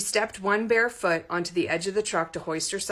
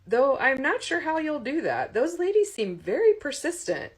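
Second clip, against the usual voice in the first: higher, louder rueful joke; a lightly voiced Todd; wry young man; 9 semitones higher, 4 dB louder than usual. A narrator's reading. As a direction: tease about the persistent ladies. Todd joking about fans.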